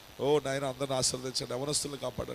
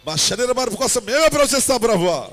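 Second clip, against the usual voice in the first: higher and louder declaration, stress on మేమే; rising fervent prayer; 10 semitones higher, 13 dB louder than usual. Acted. Pastor declaring faith to God, louder and higher. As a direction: push మేమే out with growing force.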